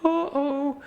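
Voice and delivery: very high scared cartoon uh-oh; Shaggy-style uh-oh, very high. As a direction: squeak it like a cartoon coward caught out. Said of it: "Shaggy and Scooby moment".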